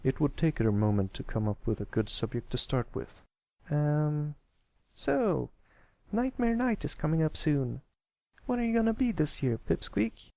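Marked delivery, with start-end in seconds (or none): 3.7-10.3 high filly voice, hesitant then quicker; shy filly opening. Acted. Apple Bloom starting a conversation with Pipsqueak, hesitant high filly voice. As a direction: break the awkward silence.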